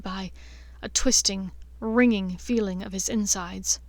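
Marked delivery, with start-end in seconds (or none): none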